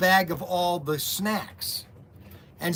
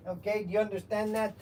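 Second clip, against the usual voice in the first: put-on comic character with a foreign accent; Mister Wiggins; 7 dB quieter than usual. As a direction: play a sketch-show boss with a thick comic accent. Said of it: "I'm gonna talk like this".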